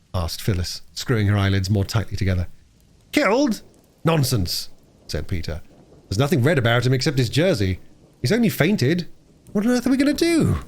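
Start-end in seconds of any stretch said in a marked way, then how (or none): 3.1-10.6 lightly voiced boy, pitched higher; dismissive, then at a loss, high-pitched; Peter, brusque young boy.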